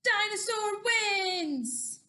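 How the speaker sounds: loud, high, stretched-out victory call; big drawn-out storybook cheer; triumphant announcement. Acted. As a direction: declare the winner slowly and loudly.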